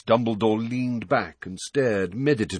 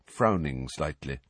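ordinary delivery, nothing marked

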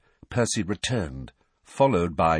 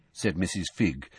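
ordinary delivery

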